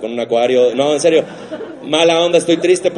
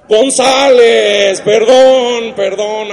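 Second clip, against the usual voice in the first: high, loud flustered correction; panicked correction; 8 semitones higher, 7 dB louder, much slower than usual. Retold or imitated. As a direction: yelp out the fix in a panic. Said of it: "un periodista de espectáculos dijo".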